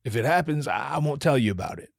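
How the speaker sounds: usual speaking voice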